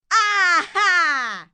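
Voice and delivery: loud wolfish aha; villain's triumphant shout. Acted. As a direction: pounce with a gotcha cry. Wolf springs on Granny.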